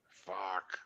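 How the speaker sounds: low whispered swear; soft, voiceless reaction to bad news; hushed curse. Real, not acted. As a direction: curse under your breath in sympathy. Sympathy for friend's ruined drone.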